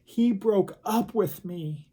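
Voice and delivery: lightly acted tearful plaint; imitated crying. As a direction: retell a heartbroken student's halting confession. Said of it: "She was crying and crying and crying".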